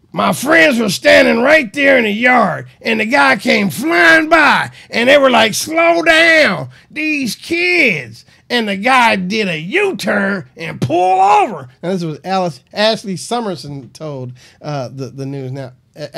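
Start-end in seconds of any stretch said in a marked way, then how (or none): 0.1-11.7 loud, higher put-on voice of a chain-smoking witness; hammed-up voice of a neighbor who smoked a lot; smoker neighbor voice. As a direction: belt it out like a raspy neighbor on the news. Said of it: "maybe she said it in a different voice".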